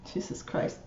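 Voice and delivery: Jesus Christ muttered under the breath, voiceless; real, hushed disbelief; whispered exasperation. Real, not acted. Vlogger appalled at the president's hairdresser's salary, whispered exasperation.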